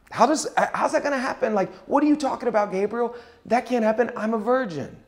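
high-pitched mock disbelief; incredulous Mary. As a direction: question the angel, pitched well up, in mock protest. Voices Mary's disbelief at the angel.

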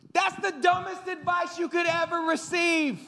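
high, real shout of disgust; yelling. Real, not acted. Preacher yelling at the congregation about bad advice, real shout. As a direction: blast the advice as dumb. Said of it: "I'm sorry I'm yelling at you".

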